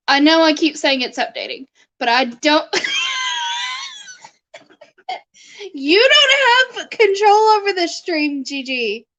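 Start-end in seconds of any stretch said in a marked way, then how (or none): 2.7-3.9 long high-pitched squeal of laughter. Real, not acted. Bursts out amid stream banter. 5.7-9.1 high, emphatic protest that falls away; playful scolding. Real, not acted. Playfully scolding a bossy viewer.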